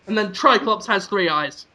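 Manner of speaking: ordinary delivery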